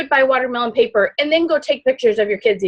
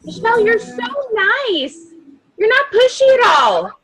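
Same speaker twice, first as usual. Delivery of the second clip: much higher, sweet, gushing praise; gushing customer; 9 semitones higher than usual. Retold or imitated. Customers praising Michelle, in a much higher gushing voice. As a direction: coo it like a delighted customer. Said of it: "guess what people are saying".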